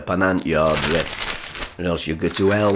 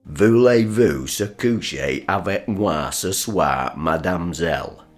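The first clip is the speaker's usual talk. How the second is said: French phrase. Acted. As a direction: roll out the French with cheeky relish. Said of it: "You say some French, Dad".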